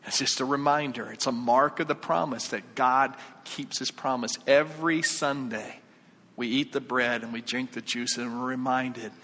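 usual speaking voice

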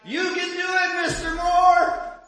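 high, loud shout from the crowd; shouting cheer. Retold or imitated. Boy shouts encouragement at his batting dad, loud cheer. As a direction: yell it out to lift him up. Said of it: "you can hear the voice again shouting".

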